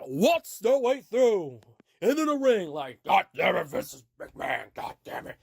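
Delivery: exaggerated impression of a wrestling boss; Vince McMahon. Boss fuming at people waltzing into the ring, hammed-up impression. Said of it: "I could sort of do a Vince".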